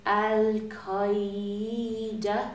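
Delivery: very slow, each syllable stretched; drawn-out foreign word. Retold or imitated. Comedian recalls the first word she recognised in Arabic class, drawn out. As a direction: stretch the word out slowly and ominously.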